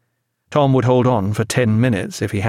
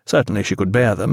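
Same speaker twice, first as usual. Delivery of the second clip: ordinary delivery, nothing marked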